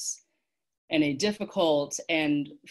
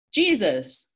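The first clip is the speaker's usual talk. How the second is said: high, loud exclamation; excited realization; 6 semitones higher, 5 dB louder than usual. A narrator's reading. Boy guesses the fighter's name, excited. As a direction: burst out with the answer.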